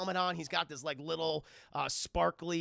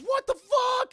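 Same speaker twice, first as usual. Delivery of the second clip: high, loud mock outcry; shocked yell; 12 dB louder, slower than usual. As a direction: yell in stunned alarm, imitating him. Man shocked by blast, retold.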